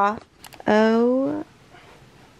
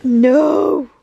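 shocked no; 4 semitones higher, 7 dB louder than usual. Real, not acted. Shocked at on-screen twist.

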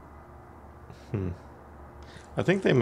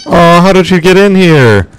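startled shout; 9 semitones higher, 9 dB louder than usual. Real, not acted. Monster suddenly appears.

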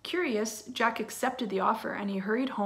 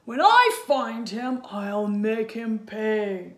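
louder, slow giant threat; threatening giant; 7 dB louder, slower than usual. Acted. Giant vowing to catch the thief, slow menacing roar. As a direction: growl out the vow of revenge. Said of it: "he roared".

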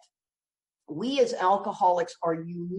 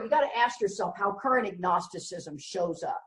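ordinary talk, nothing marked

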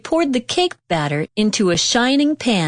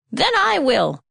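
higher, resolute hen; determined hen; 6 semitones higher than usual. A narrator's reading. Hen deciding to do the work alone, resolute. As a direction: say it like the hen settling it herself. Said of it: "said the little red hen".

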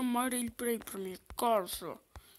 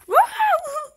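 loud high whoop; excited cheer; 15 dB louder than usual. Pretend. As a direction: whoop with joy. Skating cheer.

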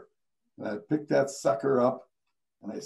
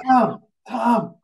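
higher, louder urgent calling; 9 semitones higher, 6 dB louder than usual. Retold or imitated. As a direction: grab his attention fast. Urgent call to colleague.

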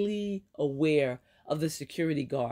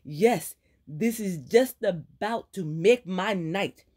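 furious friend ranting, higher and louder; angry scolding friend; 4 semitones higher, 4 dB louder than usual. Acted. Ileana ranting at Aaron about her ruined dinner, angry. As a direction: let her rip into her reckless friend.

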